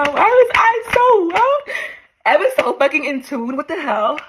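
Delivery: very high, stretched-out wail easing down; overwhelmed disbelief. Real, not acted. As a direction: cry out, floored by your own foresight. Overwhelmed by her own past letter.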